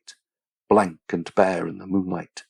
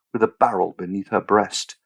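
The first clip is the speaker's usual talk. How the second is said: ordinary delivery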